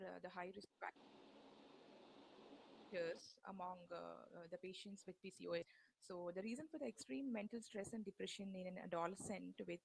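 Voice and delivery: very faint, distant voice; speaking far too softly for the mic; barely audible. Real, not acted. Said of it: "your voice is very low".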